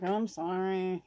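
dismissive mock apology; lightly voiced grudging kid; insincere sorry. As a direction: toss off a fake sorry. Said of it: "you're not really sorry".

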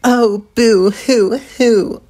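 sarcastic fake boo-hoo; mocking. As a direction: taunt them with mock sobbing. Vriska mocks the group.

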